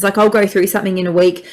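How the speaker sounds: usual speaking voice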